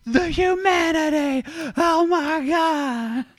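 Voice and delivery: very high, slow wail of despair; mock horror. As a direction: wail it like a disaster film.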